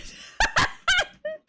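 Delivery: high squeaky burst of laughter; big high-pitched laugh. Real, not acted. After threatening Ducky.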